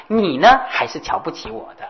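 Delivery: ordinary delivery, nothing marked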